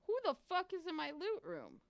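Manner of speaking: high, loud mock outraged yell; angry yell. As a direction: yell at the intruder as if furious, imitating yourself. Retelling intruder in his room.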